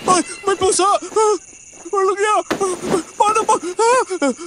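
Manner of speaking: high frantic yelps; startled out of sleep, panicking; panicked scream. Acted. Woken by cat, panicking.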